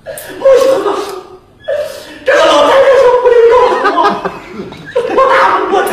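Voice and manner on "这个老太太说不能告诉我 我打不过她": high, fast, laughing protest; aggrieved vendor. Acted. Vendor venting to the nuns, high and laughing. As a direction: rattle off the grievance.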